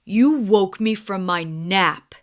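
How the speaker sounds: lightly grumpy lion voice; a hint of a sleepy grumble; grumbling lion. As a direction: woken lion complaining to the mouse, mildly grumpy. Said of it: "he grumbled".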